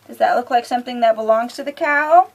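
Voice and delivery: ordinary delivery, nothing marked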